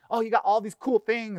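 high, eager and impressed; mock tourist wonder. Pastor plays an eager visitor, high and impressed. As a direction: gush over the cool things.

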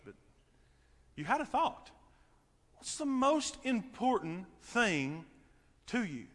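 usual speaking voice

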